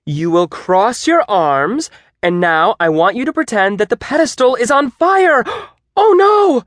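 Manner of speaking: high, theatrical teacher with mock alarm; exclaiming. A narrator's reading. Teacher asking students to imagine a fire, exclaiming dramatically. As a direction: build to a gasping, high cry of pretend horror. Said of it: "ended in an exclamation mark".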